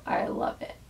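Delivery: ordinary delivery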